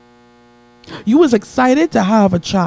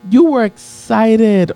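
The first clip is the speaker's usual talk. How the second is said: building preach; slower than usual. Acted. Preacher hammering the point to his congregation, slow. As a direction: stretch each word to the crowd.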